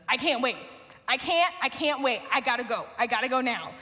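short urgent bursts, mildly acted; urgent, serious child. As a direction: imitate a kid who suddenly cannot wait. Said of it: "gets really, really serious".

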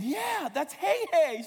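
high, slow, stretched-out revelation; mock-dramatic father. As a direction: break the awful news with mock anguish. Father breaks the news to daughter.

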